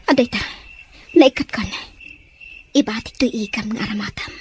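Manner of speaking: urgent whisper. Acted. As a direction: hush it so the guards won't hear. Secret rescue in prison.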